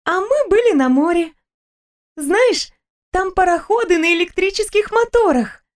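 Stella's voice. Acted. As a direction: chatter happily about a trip. Stella chatters.

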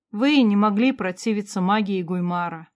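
ordinary delivery, nothing marked

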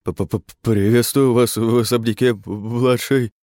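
teeth chattering stutter; shivering with cold. A narrator's reading. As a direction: stammer it, shivering. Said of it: "трясся от холода".

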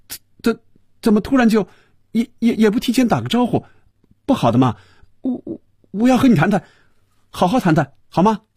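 very high-pitched, stuttering disbelief; flustered stammer. A narrator's reading. Stunned boss begs the resigning employee to talk, stammering. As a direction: trip over the first words, stunned and pleading. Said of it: "结结巴巴地说".

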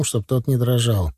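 usual speaking voice

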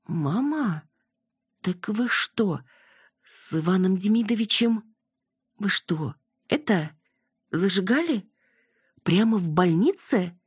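high-pitched, halting disbelief; surprised. A narrator's reading. Daughter realizing what her mother was up to, surprised. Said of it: "удивилась Яна".

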